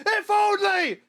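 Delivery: very high, loud wail; high-pitched desperate yell; panicked shout. Acted. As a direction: wail it in mock despair. Panicked answer to wrong guesses.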